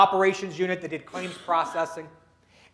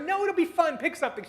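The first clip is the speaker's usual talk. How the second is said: eager coaxing; 10 semitones higher, 4 dB quieter than usual. Retold or imitated. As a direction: pitch jumps way up, keen to persuade. Pat urging reluctant employee.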